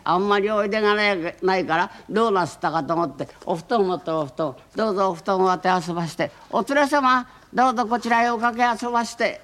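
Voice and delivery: high, polite hostess voice, fussing. Acted. Hostess fussing over the guests with cushions, high and polite. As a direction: pile on the courtesies as the mistress.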